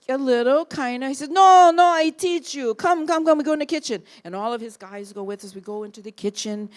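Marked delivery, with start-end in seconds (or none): 1.3-4.0 high, loud insistent Italian; Italian accent. Retold or imitated. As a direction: insist grandly in Italian English.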